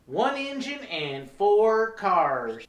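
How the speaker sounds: slow, drawn-out hillbilly reply; dumb answer. Acted. Train worker answering tourist's question, slow and mock-dim. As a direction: spell out the obvious slowly. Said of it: "throw back really dumb answers".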